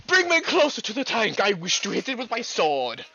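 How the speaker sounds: loud, high theatrical commander shout; over-the-top battlefield roar; Commissar battle cry. Acted. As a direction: bellow it like a zealous Commissar. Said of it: "the most Commissar shit".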